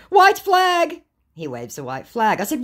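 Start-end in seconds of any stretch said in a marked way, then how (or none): 0.1-1.0 very high, loud mock cry; hammed-up high-pitched outburst; loud scammer retort. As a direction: yell it out as the scammer. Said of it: "your eardrums going".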